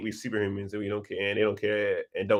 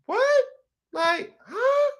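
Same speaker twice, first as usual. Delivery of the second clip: stunned disbelief; much slower than usual. Retold or imitated. Student left alone in class, stunned high-pitched disbelief. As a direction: squeal out a baffled what and huh. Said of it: "I'm just sitting there like".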